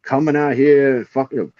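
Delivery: mock the big-city visitor in a thick rural country accent; rural hillbilly accent. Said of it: "talk like this".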